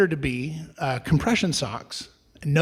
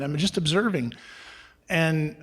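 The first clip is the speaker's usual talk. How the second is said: normal talking voice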